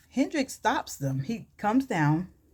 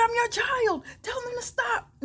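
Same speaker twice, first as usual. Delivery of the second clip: retold screaming plea. Daughter begs Kendrick while dragged away by security, mock screaming. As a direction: act out desperate pleading way up high. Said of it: "And I'm screaming".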